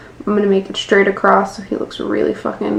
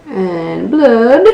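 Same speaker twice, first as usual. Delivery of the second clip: little boy Blood impression. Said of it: "Little boy saying "Blood".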